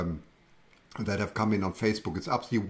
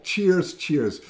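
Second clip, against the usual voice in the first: bright, raised cheers; high, louder toast; cheery toast; 5 semitones higher, 3 dB louder than usual. Real, not acted. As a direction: lift the glass and call it out. Toasting her viewers.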